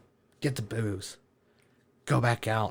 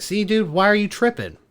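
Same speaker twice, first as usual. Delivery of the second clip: higher, louder imitation of his buddy; teasing buddy, raised voice; mock chiding; 10 semitones higher, 6 dB louder than usual. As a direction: act out the friend's dismissive question, pitched up. Imitates unbothered driver chiding him.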